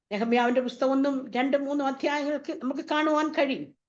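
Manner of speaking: usual speaking voice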